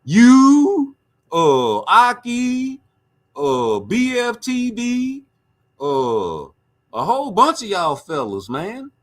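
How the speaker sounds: mocking a guest's food breath; mocking roast. Real, not acted. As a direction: lay it on thick.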